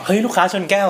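mock shout. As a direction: shout the news across the restaurant, half laughing. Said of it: "ก็ตะโกนบอก".

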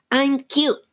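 very high squeaky puppet; Slappy. A narrator's reading. Dummy agrees with Lindsay, very high squeaky voice. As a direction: pipe up as a ventriloquist's dummy. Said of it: "she made him say".

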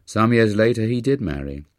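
plain reading voice

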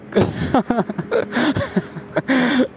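high, helpless giggle; high laugh. Real, not acted. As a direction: crack up at your own lie. Laughing at own lie.

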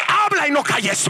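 high, forceful cry; commanding shout. Retold or imitated. Preacher re-enacts the Lord's command to him, commanding shout. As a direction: proclaim it as a ringing order. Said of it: "así me lo dijo".

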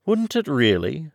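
high, surprised question; high-voiced surprised Alice; great surprise. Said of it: "in a tone of great surprise".

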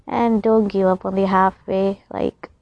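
normal talking voice

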